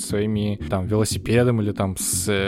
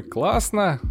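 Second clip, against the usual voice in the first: pleased; 10 semitones higher than usual. Retold or imitated. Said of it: "такой".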